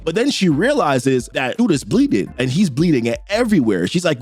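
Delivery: plain reading voice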